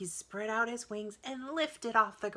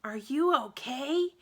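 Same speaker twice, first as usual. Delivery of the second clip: higher, louder call from afar; slow, louder worried shout; 7 semitones higher, 7 dB louder than usual. A narrator's reading. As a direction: call down from the treehouse. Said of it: "Annie shouted from the treehouse".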